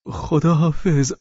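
high, drawn-out goodbye; eager farewell voice. Acted. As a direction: lift the voice and stretch the goodbye. (خداحافظی کشیده با صدای زیر)

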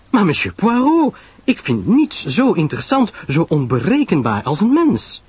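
high, astonished gushing; Pamela Lyle, protesting. Acted. Pamela protests to Poirot that people fascinate her, high and effusive.

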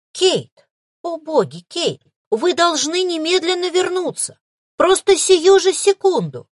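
high, loud urgent calling; urgent demand. A narrator's reading. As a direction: demand it in a rush of alarm. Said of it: "взволнованно потребовала".

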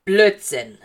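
loud, exasperated interruption; wütender Ausbruch. A narrator's reading. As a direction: cut her off with an angry outburst. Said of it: "rastete Severus aus". (laut und wütend aufbrausend)

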